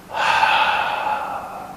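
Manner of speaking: long, breathed-out sigh without voice; sigh of relief. Shown as a demonstration. Teacher releases tension down the spine, sigh of relief. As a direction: exhale audibly to let the tension go. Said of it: "release it with a sigh of".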